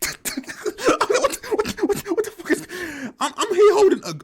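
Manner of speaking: high, breathless laughing "what, what"; laughing disbelief. Real, not acted. Retelling shock at holding gun.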